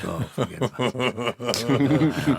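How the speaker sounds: laughing through the words; amused laugh while talking. Real, not acted. Laughing at the nickname.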